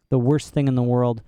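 usual speaking voice

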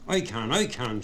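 plain reading voice

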